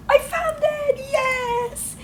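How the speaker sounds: very high triumphant shout; teacher's squealing victory cry; excited shout. Acted. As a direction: cheer like you won a prize. Teacher finding the letter.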